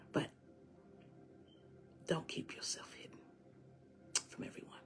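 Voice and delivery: very soft, breath-only whisper; hushed encouragement. Real, not acted. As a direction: whisper gently to comfort them, with long pauses. Hushed plea to listeners.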